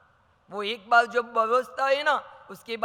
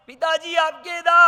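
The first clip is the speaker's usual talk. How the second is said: higher, loud urgent call; son calling out; 8 semitones higher, 10 dB louder than usual. Acted. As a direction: blurt it out to interrupt father. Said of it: "बेटा के". (बेटे की ऊँची, तेज़ पुकार)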